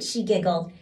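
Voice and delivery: plain reading voice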